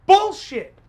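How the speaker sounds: loud, high burst on one word; explosive curse. Acted. Man caps tirade at fiancée, explosive shout. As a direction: punch it out as a shout.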